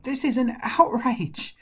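high, loud, slow protest; outraged cry. A narrator's reading. Shepherd protests at the giant's insult, high outraged cry. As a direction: cry out high, stretching the words. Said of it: "And he cried out".

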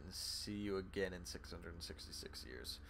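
hushed, breathy devil farewell; whisper. Acted. Player voicing the devil's goodbye to the king in a whisper. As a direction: whisper it like a sinister promise. Reading the devil's parting line.

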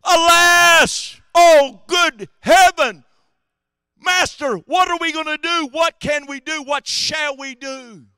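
high, loud frightened cry; panicked servant. Acted. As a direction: wail it out in terror to the master. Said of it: "his servant said unto him".

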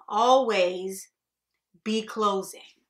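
loud, slow, emphatic sales motto; hammered slogan. Acted. Boss drilling the motto into salesmen, loud and forceful. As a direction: pound out each part of the motto.

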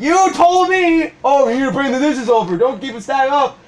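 high-pitched, hammed-up tirade; mock shouting at a coworker; retold angry rant. Acting out imagined rant at coworker.

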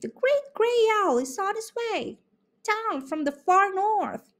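very high bird call-out; very high, bright bird voice announcing; calling chickadee. A narrator's reading. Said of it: "called out the chickadee".

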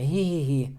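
high snickering hee-hee-hee; a narrator's hammed-up giggle; mock neigh-like giggle. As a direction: snicker like a horse. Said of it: "Чого іржиш?".

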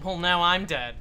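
loud exasperated announcement; raging gamer. Real, not acted. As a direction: blurt it out loud and fed up. Died in game.

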